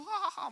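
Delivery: high-pitched mock "hahaha"; imitated laugh. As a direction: fake a date's helpless laugh. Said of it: "막 이렇게 웃더라고요". (높은 목소리로 흉내 낸 웃음)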